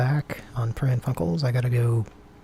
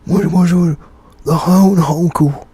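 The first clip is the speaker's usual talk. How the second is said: loud, higher silly character voice; Moja voice; 6 semitones higher, 9 dB louder than usual. Retold or imitated. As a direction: shout it like Moja would. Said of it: "as Moja would say".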